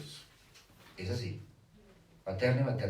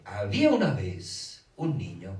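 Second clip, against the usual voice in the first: storytelling opener; 2 semitones lower, 7 dB louder, slower than usual. Shown as a demonstration. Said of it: "la palabra clave".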